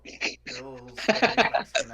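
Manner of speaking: loud laughing tease; laughing teasing. Real, not acted. Friend teasing his accent while laughing loudly. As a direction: laugh it out while ribbing him.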